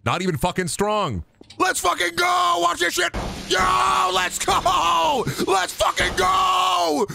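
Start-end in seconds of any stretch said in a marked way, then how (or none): 1.6-7.1 hyped yell. Real, not acted. Hyped victory cheering.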